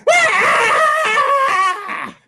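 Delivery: long, very high scream; piercing high acted shriek; scared scream. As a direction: scream as the frightened old woman. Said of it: "Scared that old woman just like".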